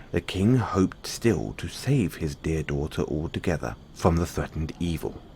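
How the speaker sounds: plain reading voice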